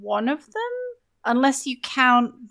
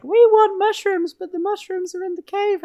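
higher mock NPC plea, louder on mushrooms; whiny NPC voice; 9 semitones higher, 4 dB louder than usual. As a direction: play the needy quest-giver asking, pitched up. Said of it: "they're like".

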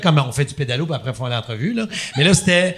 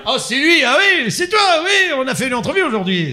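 high, louder gushing welcome; mock delighted singer; 9 semitones higher, 4 dB louder than usual. [name] greeting the interviewer after the show, high gushing delight. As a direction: gush with fake delight. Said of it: "puis j'ai fait".

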